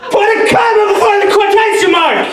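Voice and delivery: high-pitched outburst, yelled in one burst; frantic shout. Acted. Teacher blurting grammar at his class instead of swearing, frantic shout.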